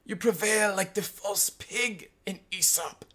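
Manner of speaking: slow, high professorial sneer; mock-academic insult, pitched high. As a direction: taunt in a drawn-out high voice. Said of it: "he sneered".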